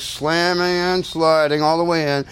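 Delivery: ordinary delivery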